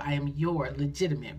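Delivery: ordinary talk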